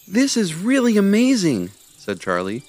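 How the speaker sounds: high squirrel voice, glad; happy squirrel. Acted. Charlie to Levi and Ivy, happy squirrel voice. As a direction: chirp out the good news.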